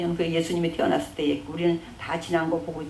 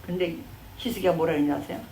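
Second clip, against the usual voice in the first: normal talking voice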